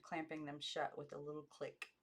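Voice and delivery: plain reading voice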